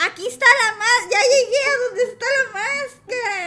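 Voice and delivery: loud, high, near-sobbing cry of joy; yelling out in relief at the find; excited shout. Real, not acted. Gamer shouting with joy at the mask.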